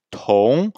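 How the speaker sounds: "tong" with a puff of air on the T, tone rising; rising "tong" with an airy T; aspirated T. Shown as a demonstration. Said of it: "le T est une consonne aspirée".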